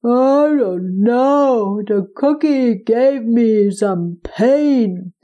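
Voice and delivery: slow, pained giant voice; a hurting giant, slow and heavy. Acted. Giant voiced in told story.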